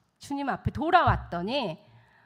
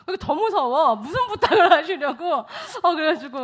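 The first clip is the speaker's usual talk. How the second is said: laughing; 6 semitones higher, 9 dB louder than usual. Real, not acted. Joking aside about the request.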